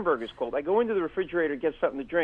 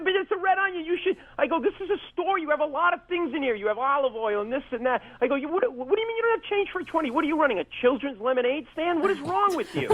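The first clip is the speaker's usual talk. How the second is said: high, incredulous mock outrage; exasperated rant; 12 semitones higher than usual. Comic ranting at fake store manager, high incredulous outrage. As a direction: berate him in disbelief, pitched way up.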